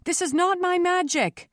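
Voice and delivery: high, insistent cry of denial; shouting over uproar. A narrator's reading. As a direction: pitch it above an angry crowd. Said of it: "trying to pitch her voice above the angry roar".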